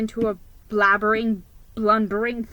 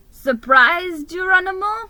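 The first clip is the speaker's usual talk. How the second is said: shouting; 9 semitones higher, 6 dB louder than usual. A narrator's reading. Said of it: "he shouted".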